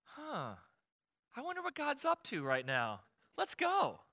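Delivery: mock serene wonder, high and soft; hammed-up eager musing, soft then leaping high; lightly curious, sing up into a high pitch; Mary. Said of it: "I don't think Mary was going".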